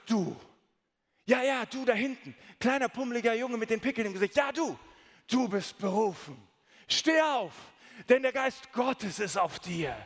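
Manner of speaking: put-on preacher summoning the pimply boy from the back row, rising to a shout; calling preacher. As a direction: point into the crowd and call him out like a revival preacher. Guest preacher calls out boy.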